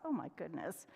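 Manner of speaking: ordinary delivery, nothing marked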